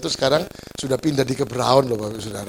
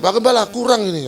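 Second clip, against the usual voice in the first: raised-pitch congregant's complaint; mock protest; 6 semitones higher than usual. Congregant tells the pastor there are too few, mild mock protest. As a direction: play a member complaining a bit higher. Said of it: "protesnya gini".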